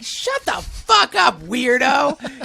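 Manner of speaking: imitating his younger self, high and hammed-up; mock outraged yell. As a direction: sneer it out like a cynic scoffing at a weirdo. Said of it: "I can remember just being like".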